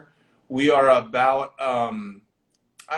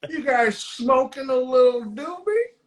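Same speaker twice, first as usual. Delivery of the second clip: high-pitched, taunting sheriff imitation; mock cop voice; 11 semitones higher than usual. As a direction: play the cop toying with suspects. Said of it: "he takes his flashlight and goes".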